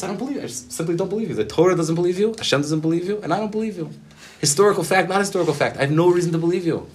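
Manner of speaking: high, insistent rebuttal; emphatic refusal. Retold or imitated. Rabbi refusing the mother's testimony, emphatic and insistent. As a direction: build to a loud, high-pitched insistence.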